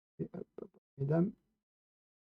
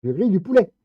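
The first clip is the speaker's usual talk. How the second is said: loud gleeful boast, pitched up; 6 semitones higher, 10 dB louder than usual. Real, not acted. Player lasering monsters, a loud gleeful boast.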